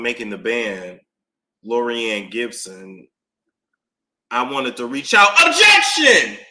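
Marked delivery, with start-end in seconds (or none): 5.5-6.4 sharp loud objection call; loud courtroom objection; shouted objection. Retold or imitated. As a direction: bark it out like a lawyer in court.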